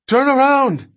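high, loud, urgent scream; panicked scream. Acted. King begs the driver to flee the tyrannosaur, screaming. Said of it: "King screamed".

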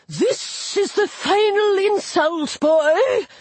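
high, slow hissing air god. A narrator's reading. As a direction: hiss like air from a balloon. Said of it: "His voice hissed like air".

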